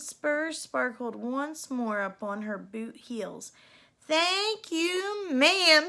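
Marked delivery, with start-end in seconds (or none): 4.1-5.9 higher, bright thanks with a louder ma'am; grateful cry. A narrator's reading. Cindy thanking the fairy godmother. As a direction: call out your thanks. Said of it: "she cried".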